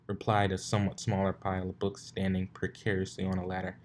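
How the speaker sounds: ordinary delivery, nothing marked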